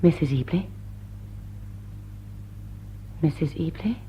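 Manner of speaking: low, soft, questioning call; tentative hushed call into the dark. Acted. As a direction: call out softly so as not to wake her. Calling softly in dark room.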